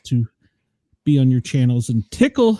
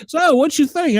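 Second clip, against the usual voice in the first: a hammed-up Southern twang, pitched higher; mock Southern drawl; 10 semitones higher than usual. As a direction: play the Southern good old boy, putting on a twang. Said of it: "the more Southern I'd get".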